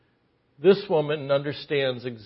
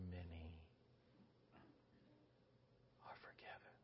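whisper; 6 semitones lower, 32 dB quieter than usual. Acted. Hushed words of Jesus.

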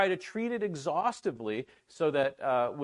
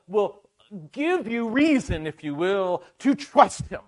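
louder, slow and driving; emphatic preaching; 6 semitones higher, 7 dB louder, slower than usual. Acted. Preacher to congregation, driving home his goal, emphatic. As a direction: press each phrase home.

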